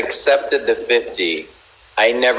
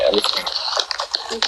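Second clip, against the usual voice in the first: trailing off, worn out and soft; fading weakly from post-op fatigue; weak after surgery; 6 dB quieter than usual. Real, not acted. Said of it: "I had surgery this morning".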